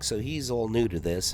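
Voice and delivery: ordinary talk, nothing marked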